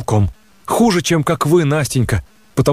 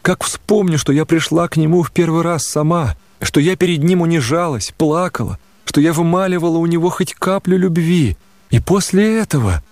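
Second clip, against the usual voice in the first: plain reading voice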